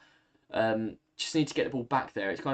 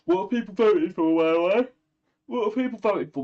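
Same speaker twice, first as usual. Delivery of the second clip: calling out; 8 semitones higher, 6 dB louder than usual. Real, not acted. Calls out question to chat.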